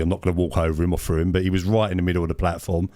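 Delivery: ordinary delivery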